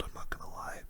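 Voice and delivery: ordinary talk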